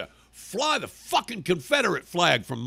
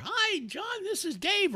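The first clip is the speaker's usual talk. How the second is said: squeaky mock greeting; campaign texter. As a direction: parody a canvasser in high falsetto. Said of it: "or whatever the name he said".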